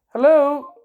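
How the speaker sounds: loud repeated hello, waiting for a reply; calling out on phone. Real, not acted. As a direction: call down the phone for someone to answer. Calling into silent phone line.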